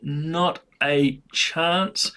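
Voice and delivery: "not a chance" drawn out very slowly; slow emphatic. Real, not acted. Repeated punchline dragged out.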